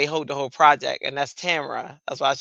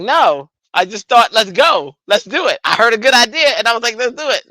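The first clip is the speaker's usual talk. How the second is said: eager to go; 6 semitones higher, 7 dB louder than usual. Retold or imitated. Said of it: "And I'm like".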